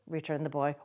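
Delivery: ordinary delivery, nothing marked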